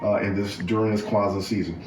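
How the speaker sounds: soft, reverent grace before the meal; bow your head and bless the food softly; mealtime prayer. Real, not acted.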